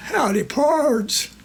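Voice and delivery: high, slow, drawn-out cowboy greeting; drawled howdy. Retold or imitated. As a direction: stretch it out like a showy cowpoke. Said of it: "this fella pops out and drawls".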